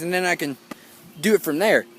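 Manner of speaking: ordinary delivery, nothing marked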